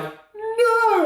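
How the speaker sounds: sudden high yelp of dismay; mock dismayed cry. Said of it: "I was like".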